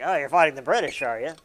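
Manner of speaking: loud, knowing "Oh, you're fighting the British"; sly mock Lafayette. Lafayette eyeing a chance to fight Britain, sly and loud. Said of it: "It's like".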